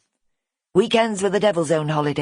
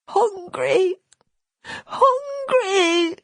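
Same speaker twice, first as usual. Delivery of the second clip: put-on high wailing child voice, rising on the second; a little lad crying out for food; crying small boy; much slower than usual.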